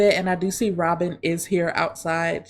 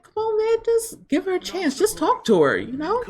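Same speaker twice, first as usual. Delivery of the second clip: voicing Reese, coaxing; 10 semitones higher than usual. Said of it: "And Reese is like".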